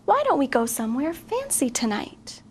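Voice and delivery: usual speaking voice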